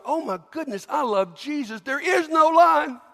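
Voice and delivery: overjoyed believer rising to the peak; joyful exclamation. Pretend. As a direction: burst out in delight. Joyful churchgoer voiced.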